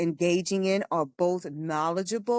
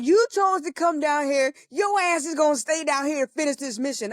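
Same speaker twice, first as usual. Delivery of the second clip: bossy sister; 9 semitones higher, 4 dB louder than usual. Retold or imitated. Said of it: "She was like".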